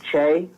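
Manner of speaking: Jachai said with a CH sound, as "juh-CHAY"; mispronounced name. Shown as a demonstration. Host admitting an old misreading of a player's name, mispronounced. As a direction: say Jachai wrongly, with CH as in chair. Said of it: "it's not pronounced that way".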